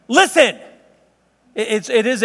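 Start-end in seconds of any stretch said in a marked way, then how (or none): 0.1-0.6 high, loud "Listen." to a crowd from a boat; commanding call. Acted. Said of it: "and he says".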